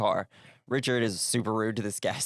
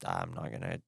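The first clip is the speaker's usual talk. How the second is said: meek inner voice; 5 semitones lower, 10 dB quieter, faster than usual. Retold or imitated. Inner thoughts avoiding salesman.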